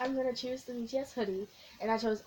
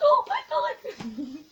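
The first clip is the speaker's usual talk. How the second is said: high, loud squealing oh my god; 10 dB louder, much slower than usual. Real, not acted. Fan opening the BTS merch box, squealing with joy. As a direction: squeal it out slowly with laughter. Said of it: "I'm squealing".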